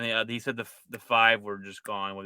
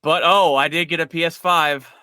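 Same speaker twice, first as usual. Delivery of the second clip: bursting with excitement, louder and higher; excited; 6 semitones higher, 9 dB louder than usual. Real, not acted. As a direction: announce it like big news. Excited news of getting PS5.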